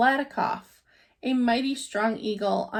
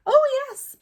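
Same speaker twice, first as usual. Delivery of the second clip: much higher, piping little voice; piped tiny voice; 6 dB louder than usual. A narrator's reading. Mayor answers Horton from the speck, high piping yes. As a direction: pipe it up brightly like a tiny creature. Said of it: "piped the voice".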